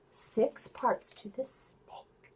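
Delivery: slow, soft unvoiced whisper. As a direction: whisper it slowly with wonder. Whispered reveal.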